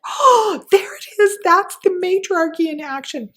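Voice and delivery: high, loud delighted exclaim; excited cry. Retold or imitated. Woman cheering a TV charge nurse, high excited cry. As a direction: cry out in triumph at the screen.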